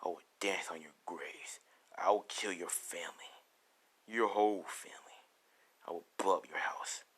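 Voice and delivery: low, near-whispered menace; cold-blooded and quiet, pitched well down; ruthless threat. Acted. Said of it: "how ruthless Deku sounds".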